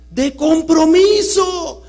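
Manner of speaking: "compromiso" shouted high and slow; stress on compromiso. Shown as a demonstration. Speaker to the audience, shouting "compromiso" high and slow. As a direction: belt out "compromiso" as the key word. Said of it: "Fíjese la palabra".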